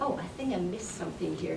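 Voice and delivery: normal talking voice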